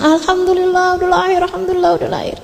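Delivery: high, happy relief at a birth; a family cheering the newborn; gembira. Retold or imitated. Keluarga gembira menyambut kelahiran. (seruan gembira keluarga)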